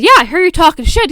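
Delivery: loud, high-pitched retort; confrontational. Retold or imitated. She repeats her accusation to the dude, confrontational. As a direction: press the accusation loudly. Retold confrontation, pressing him.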